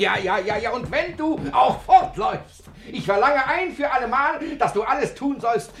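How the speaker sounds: gloating command. Acted. Father laughing, commanding daughter.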